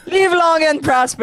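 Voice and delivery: long live and prosper, giggling through the words; laughing through it. Real, not acted. Tired host laughing at her own slip, laughing. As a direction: crack up while correcting the phrase. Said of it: "I'm very tired".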